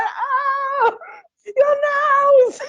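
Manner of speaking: panicked cry. Retold or imitated. Danny yelping as the badger owner's nails dig in, high panicked cry. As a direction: squeal as if nails dig into you. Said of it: "He's going".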